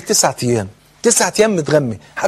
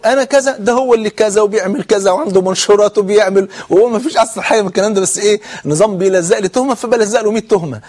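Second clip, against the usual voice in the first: higher, hurried mimicry of accusers; frantic retold blaming; 4 semitones higher than usual. Officer imitating detainees pinning charges on each other, agitated and high. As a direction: pile up accusations, pitched up and insistent.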